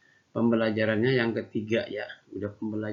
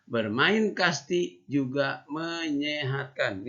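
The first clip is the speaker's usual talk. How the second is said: slow, higher storybook reading; reading to children; 4 semitones higher, much slower than usual. Shown as a demonstration. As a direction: read each word clearly for little kids. Teacher reads story to class.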